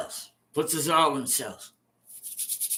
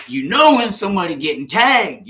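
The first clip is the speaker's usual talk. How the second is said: normal talking voice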